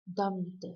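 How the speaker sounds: stressed "dum", unstressed "da"; strong-weak beat, "DUM da"; trochaic stress. Shown as a demonstration. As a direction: hit "dum" and let "da" fall away. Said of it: "stressed syllable and ends with an unstressed".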